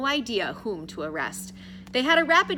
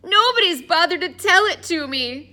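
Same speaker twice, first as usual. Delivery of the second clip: high, loud exasperated protest; exasperated, pitched-up outburst; frustrated; 10 semitones higher, 9 dB louder than usual. A narrator's reading. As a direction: complain at the top of your voice. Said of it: "said Addison in frustration".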